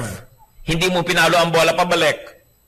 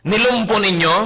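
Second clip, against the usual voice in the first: ordinary talk, nothing marked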